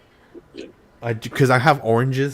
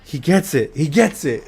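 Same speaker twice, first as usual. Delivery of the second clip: higher-pitched, thrilled realization; excited recognition; 5 semitones higher, 4 dB louder than usual. Retold or imitated. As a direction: relive feeling finally understood. Retold thrilled reaction to Kenji.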